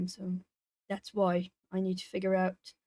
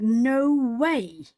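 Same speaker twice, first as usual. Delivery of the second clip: drawn-out, emphatic retold disbelief; mock-incredulous, leaning on each word; 4 dB louder than usual. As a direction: act out being stunned by good news. Acts out disbelief at the news.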